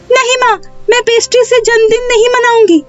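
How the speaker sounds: put-on very high, protesting child voice; upset little girl. Neha refuses a pastry birthday to her mother, upset high child voice.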